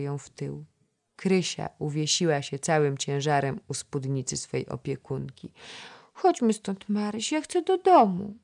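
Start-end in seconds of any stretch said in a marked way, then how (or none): 6.1-8.3 put-on higher, whining small girl; squeaky little child. A narrator's reading. Little girl begging her guardian to go home, squeaky. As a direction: pipe it like a child near tears. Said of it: "piszczało dziecko".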